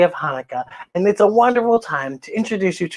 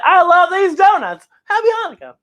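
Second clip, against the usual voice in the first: Mama voice; 10 semitones higher, 5 dB louder than usual. Acted. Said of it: "She's like".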